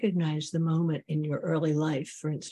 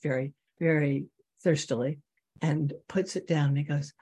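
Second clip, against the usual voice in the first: ordinary delivery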